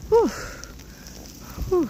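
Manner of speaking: panting after trudging through snow; short winded exhales; out of breath. Real, not acted. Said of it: "I gotta catch my breath".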